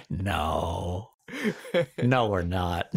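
low, drawn-out "no", softly delivered; quiet, low-pitched mock refusal; retold refusal. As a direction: say it low and slow, as a deadpan denial. Retold refusal to Tony Soprano comparison.

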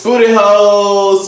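shouted gag. Acted.

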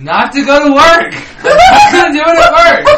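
high, loud, laughing yell; laughing shout. Real, not acted. Laughing protest.

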